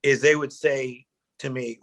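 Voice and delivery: ordinary talk, nothing marked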